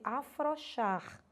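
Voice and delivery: "afrouxar" dito afroxar, sem o U; pronúncia popular errada. Shown as a demonstration. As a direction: diga "afrouxar" com O simples, sem ditongo. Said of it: "as pessoas costumam dizer". ("afrouxar" como o povo fala, afroxar)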